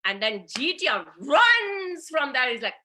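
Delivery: ordinary delivery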